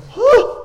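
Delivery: very high, loud mock gasp; sharp gasp of acted shock; startled gasp. Preacher acts Gideon being told he won't die, startled gasp.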